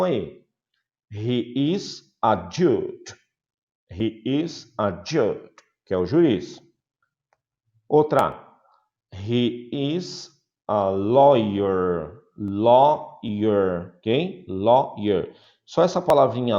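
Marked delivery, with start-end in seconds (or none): 1.1-5.5 slow model sentence. Shown as a demonstration. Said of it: "slow model sentence". 9.1-15.3 slow model sentence. Shown as a demonstration. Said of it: "slow model sentence".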